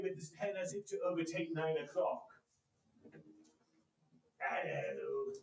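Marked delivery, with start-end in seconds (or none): 4.4-5.3 a mild put-on growl, lower; feigned growl. Scrooge to late clerk Bob, mild feigned growl. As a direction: fake the old grumpy Scrooge. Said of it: "growled Scrooge in his accustomed voice".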